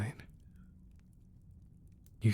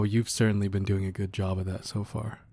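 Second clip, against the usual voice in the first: ordinary talk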